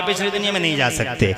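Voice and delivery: ordinary talk, nothing marked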